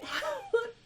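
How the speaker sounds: a sharp, high burst of disbelieving laughter; exasperated high laugh. Real, not acted. Laughing in disbelief at the deleted post.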